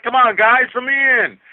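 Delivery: high, loud call through a locked door; mock plea shouted from outside the door; pleading through door. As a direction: yell to be let in, half-mocking. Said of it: "and Blackie's like".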